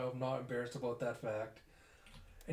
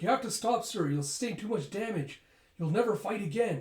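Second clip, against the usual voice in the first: urgent higher, louder begging; desperate plea; 7 semitones higher, 5 dB louder than usual. Acted. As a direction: plead with him to stop before it's too late. Aide begs commander to stop.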